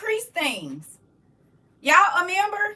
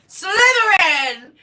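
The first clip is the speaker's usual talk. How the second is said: slow, higher, laughing shout; sorting hat shout; 5 semitones higher, 4 dB louder, much slower than usual. Pretend. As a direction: call the house like the hat. Imitating the sorting hat calling.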